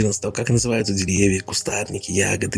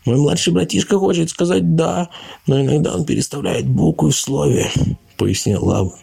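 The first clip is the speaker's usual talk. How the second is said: glib sly explainer; 7 semitones higher than usual. Pretend. Laban explains away Ludwig's cry to the bunnies, higher and slick. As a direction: talk fast cover for the brother.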